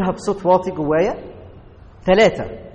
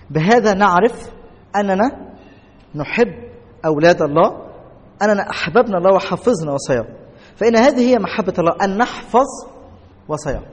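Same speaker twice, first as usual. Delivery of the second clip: scripture reading; 3 dB louder than usual. Acted. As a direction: let each phrase land before the next. Reads scripture aloud.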